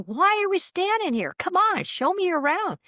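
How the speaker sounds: high-pitched, impatient excitement; retold eagerness. Replaying her plea to friend for a tour, eager.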